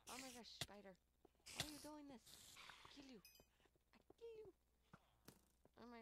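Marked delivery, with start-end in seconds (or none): 0.1-2.3 high, loud startled cry, Oh my gosh, spider; startled squeal at a spider. Real, not acted. Gamer startled by a spider, yelping high and loud. 2.7-4.5 high, slow mock villain threat, I kill you; a hammed-up cartoon menace. Gamer threatening the spider in a high mock villain voice.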